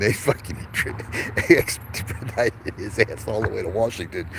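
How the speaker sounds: talking through real laughter; breaking up laughing. Real, not acted. Joking to listeners about his story, breaking up laughing. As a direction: crack up mid-sentence, struggling to get it out. Cracks up at his own joke.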